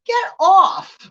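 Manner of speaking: high, loud hammed-up get off; mock yell. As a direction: shriek it off. Acted shove-off of grabber.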